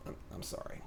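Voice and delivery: tiny whispered mock apology. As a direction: murmur it like a sheepish Canadian.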